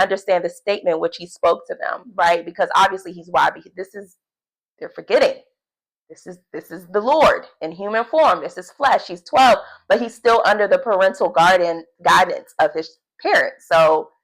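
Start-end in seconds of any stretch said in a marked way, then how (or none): none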